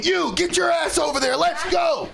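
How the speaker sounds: high, shouted order, imitating a dad; angry father. Another father pulls his child out, yelling angrily. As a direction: yell at your kid to leave now.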